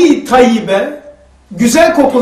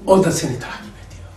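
low, hushed and fast teasing; mock paranoid; 7 semitones lower, 10 dB quieter, faster than usual. Teasing a paranoid friend in a mock hushed voice. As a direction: mimic his paranoia to mock him. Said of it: "onlarla dalga geçmek için diyorum ki".

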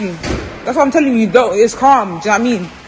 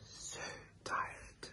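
whispering; 18 dB quieter than usual. Real, not acted. Sleepy early morning vlog.

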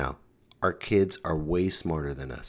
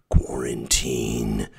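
"Quarantine" drawn out very slowly and pitched lower; slow ominous quarantine; 7 semitones lower, much slower than usual. Shown as a demonstration. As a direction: stretch "Quarantine" slow and low like a doom toll. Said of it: "second drawn-out take".

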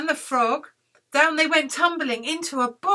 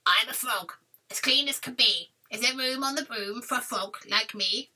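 frog character with a croak; 3 dB quieter than usual. Acted. Frog asks witch for room, croaking. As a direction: croak out a polite request. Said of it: "said with a croak".

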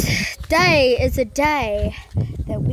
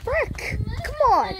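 frustrated outburst; 9 semitones higher than usual. Real, not acted. Trips over something.

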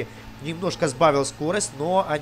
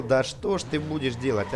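usual speaking voice

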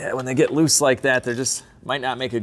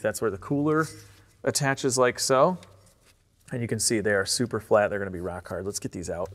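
usual speaking voice

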